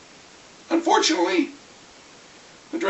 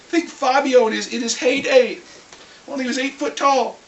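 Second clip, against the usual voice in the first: ordinary delivery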